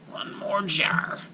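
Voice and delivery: whispered slow witch mumble; mumbled. A narrator's reading. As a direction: mutter it to herself like a hag. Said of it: "Baba Yaga mumble".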